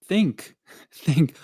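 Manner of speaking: "think" said high and punched; emphatic think. Real, not acted. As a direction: stress "think" twice, insistent. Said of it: "All I want you to do is think".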